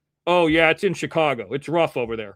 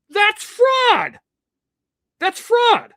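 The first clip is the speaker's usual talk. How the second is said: shouted high and much louder; outraged shout; 13 semitones higher, 6 dB louder, much slower than usual. Real, not acted. Calling a rival streamer out for fraud, shouting. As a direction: yell it like a furious accusation.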